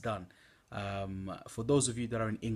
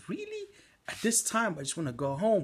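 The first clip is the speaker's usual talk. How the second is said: "Really?" shot up high in disbelief; tired, put-upon reluctance; can't believe it at midnight; exasperated; 8 semitones higher than usual. Retold or imitated. Exasperated at being asked again.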